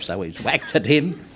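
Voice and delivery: ordinary delivery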